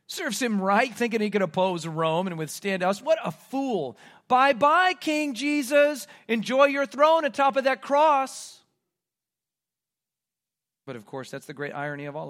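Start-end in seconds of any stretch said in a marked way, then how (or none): 0.1-8.6 scornful, building to a high, loud jeer; taunting. Acted. Soldiers sending the mock king to his cross, taunting. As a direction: jeer him off with a mock farewell.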